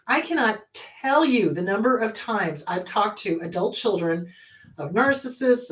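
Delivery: normal talking voice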